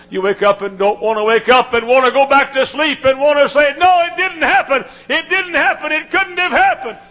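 high, loud cry of disbelief; a grieving listener refusing the news; anguished denial. Retold or imitated. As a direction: cry out that it cannot be true.

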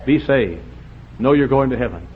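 ordinary talk, nothing marked

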